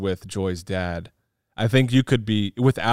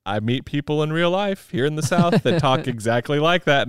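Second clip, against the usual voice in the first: ordinary delivery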